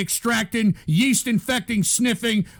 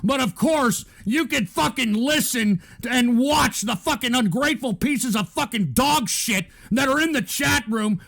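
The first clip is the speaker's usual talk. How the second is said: ordinary talk, nothing marked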